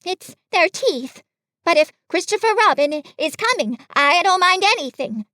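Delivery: high, earnest little voice. A narrator's reading. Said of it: "said Piglet earnestly".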